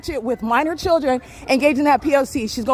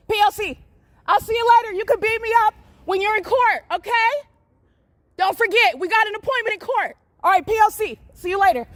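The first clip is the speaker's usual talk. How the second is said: higher, sing-song taunt; mocking farewell; 7 semitones higher than usual. Real, not acted. Filming woman she accuses, mocking see-you-in-court taunt. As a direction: tease her that the court date is coming.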